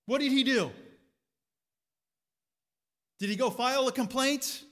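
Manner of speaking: loud, high, driving sermon voice; full-force preaching. Acted. Preacher urging his church to keep praying, loud and high.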